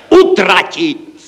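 high, loud, drawn-out cry; exclaimed shout. A narrator's reading. Clerk proposes spending it all, shouting it out. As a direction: shout the idea out, stretching it. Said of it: "zvolal jsem já".

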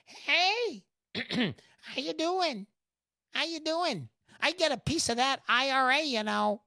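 a lightly voiced government man asking for his cut. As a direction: sidle up like a smarmy tax collector. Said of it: "That's what the government says".

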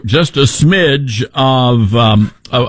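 usual speaking voice

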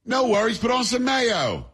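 high, slow hammed-up British voice; exaggerated high English accent; mock British accent. Mocking British cooks, acted.

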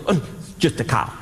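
a flat, low, offhand machine voice; a lightly voiced sensor. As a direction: shrug it off like a bored little sensor. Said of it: "a little sensor in there that goes".

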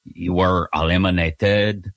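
bad French accent. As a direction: ham up a clumsy French accent as a joke. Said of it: "This is a really bad French accent".

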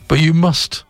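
firm, pleading priest; insisting. Acted. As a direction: press the point, high and firm. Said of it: "the priest insisted".

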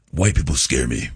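low, heavy-voiced impression; Paul Mooney. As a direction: drop the voice down, heavy and weighty. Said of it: "Paul Mooney's voice is really".

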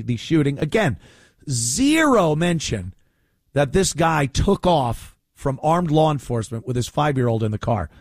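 normal talking voice

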